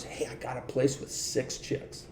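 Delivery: imitating a friend, very softly; hushed buddy quote. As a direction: retell it as a hushed tip-off. Buddy sharing news in retold quote.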